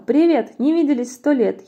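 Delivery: high, hearty shouted greeting; mouse crying out. A narrator's reading. Mouse greets the snake like an old pal, loud hail. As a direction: hail someone loudly in a high voice. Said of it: "мышонок закричал".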